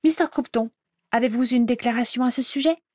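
high, fast, mock-polite probing; pushy reporter question. Rita Skeeter grilling Croupton, high and fast. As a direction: corner him with fake politeness.

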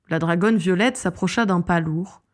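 ordinary delivery

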